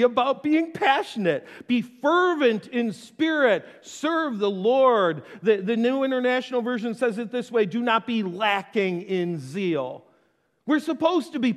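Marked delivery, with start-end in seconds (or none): 1.7-5.2 slow deliberate reading. Acted. Reading scripture slowly. 7.6-10.0 slow scripture reading. Acted.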